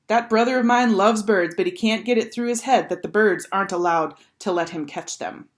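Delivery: usual speaking voice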